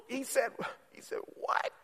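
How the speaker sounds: hushed, incredulous mock gasp of words; stunned disbelief. Congregant reacting to Jesus's words, hushed disbelief. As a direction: drop to a scandalized near-whisper.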